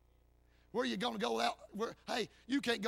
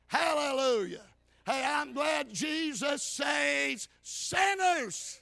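slow, drawn-out preacher's cry; hollering praise; 4 semitones higher, 4 dB louder, much slower than usual. Real, not acted. Preacher glad that Jesus saves sinners, hollered praise. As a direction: stretch it into a glad proclamation. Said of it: "all that hollering".

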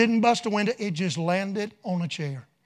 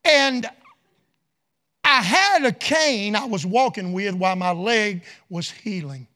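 ordinary talk

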